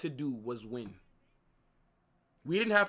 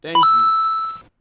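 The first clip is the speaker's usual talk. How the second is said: sudden loud shout; 3 semitones higher, 18 dB louder than usual. Real, not acted. Streamer thanks a donor mid rant.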